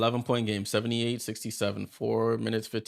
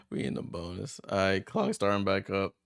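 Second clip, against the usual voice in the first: ordinary talk, nothing marked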